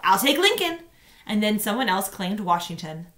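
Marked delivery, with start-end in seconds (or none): 0.0-0.8 higher, louder eager call; a kid blurting out a claim; shouted out. Retold or imitated. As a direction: classmate shouting to claim Lincoln. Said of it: "someone else had shouted out".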